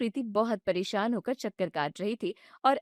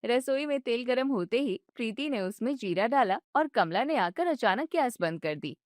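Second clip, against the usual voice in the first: ordinary talk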